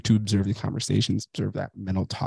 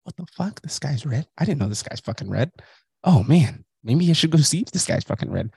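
higher-pitched, incredulous questioning; mock astonishment; 6 semitones higher than usual. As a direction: play up the disbelief. Mock astonished repeating back.

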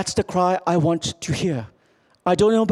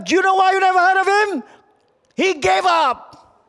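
coach yelling; 12 semitones higher, 4 dB louder than usual. Retold or imitated. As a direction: hammer the lesson home at the team. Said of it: "The coach yelled at them".

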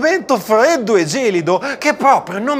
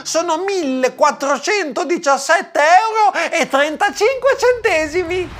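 high-pitched cashier voice; put-on high checkout voice; cashier presenting the bill; 7 semitones higher than usual. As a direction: announce an enormous total to a customer.

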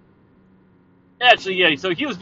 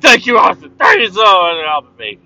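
grateful shout; 3 semitones higher, 6 dB louder than usual. Retold or imitated. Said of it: "And they're like".

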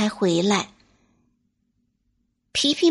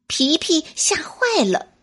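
ordinary delivery

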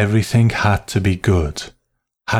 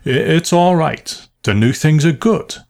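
higher, stammered start with a forced smile; hasty reassurance; 8 semitones higher than usual. A narrator's reading. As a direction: reassure hastily, covering nerves. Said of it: "smiling hastily".

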